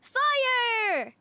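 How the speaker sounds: high shouted order in play; pretend battle cry. Chieftain orders Philip to fire the cannonball, shouting. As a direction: yell the command to fire.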